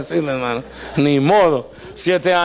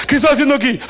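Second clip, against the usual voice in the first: alarmed question; 7 semitones higher, much faster than usual. Retold or imitated. Startled husband questions bride.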